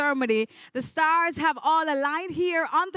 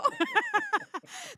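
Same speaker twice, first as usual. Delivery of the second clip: high laugh. Real, not acted. Host laughs after swirl.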